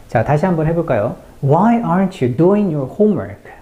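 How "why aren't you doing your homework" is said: slow, higher take stressing "Why aren't", "doing", "homework"; sentence stress demo. Shown as a demonstration. Teacher models again for learners, high slow stress on "Why aren't", "doing", "homework". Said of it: "이렇게 발음을 해보시면 되겠습니다".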